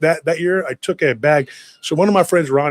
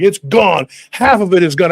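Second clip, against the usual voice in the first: put-on raised, loud indignant kid; standing my ground; 4 semitones higher, 5 dB louder than usual. Kid standing up to mom over candy, indignant protest. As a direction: protest the loss with mock outrage. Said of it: "I stood my ground".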